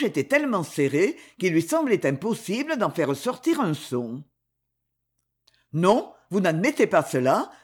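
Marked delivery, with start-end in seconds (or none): none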